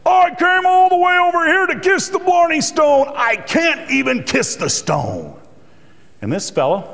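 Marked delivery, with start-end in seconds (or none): 0.0-5.3 furious outburst. Retold or imitated. Lady kept from kissing the Blarney Stone, furious shout. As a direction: explode like a tourist denied her wish. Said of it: "this lady flew off the handle".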